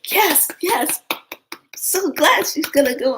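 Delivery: high, loud delighted cheer; imitated cheering. Everybody applauds the newcomer, imitated excited cheering. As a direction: play a whole group rejoicing.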